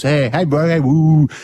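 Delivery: lightly voiced lads calling out; mates calling out. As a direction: call out like gym lads. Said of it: "usses there".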